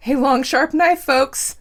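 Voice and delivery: loud, high breaking outburst; shouting. Acted. Clown shouting at viewers on live TV, voice breaking. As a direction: cry it out at the camera.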